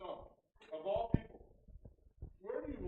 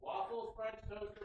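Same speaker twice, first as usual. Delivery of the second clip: pitched up high, starting on a leap; with some oomph; 6 semitones higher, 4 dB quieter, slower than usual. Shown as a demonstration. Player repeats the demo for host, with oomph. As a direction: read the choices high, with oomph. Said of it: "say it with some oomph".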